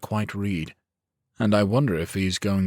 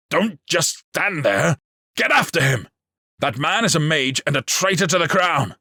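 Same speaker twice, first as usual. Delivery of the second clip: furious order; 10 semitones higher, 6 dB louder than usual. A narrator's reading. Lord ordering guards to chase the traitor, furious shouting. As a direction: bellow the command in rage.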